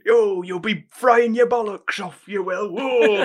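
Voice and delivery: hammed-up mock Cockney villain; bad Cockney accent. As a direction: parody a god-awful fake Cockney henchman. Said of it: "Cockney accent".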